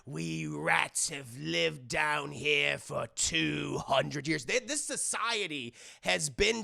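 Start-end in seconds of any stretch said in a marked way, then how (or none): 0.0-4.5 very slow, weighty pirate rat; drawn-out pirate storytelling; solemn pirate rat. Retold or imitated. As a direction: intone it like an ancient legend. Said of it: "He's like".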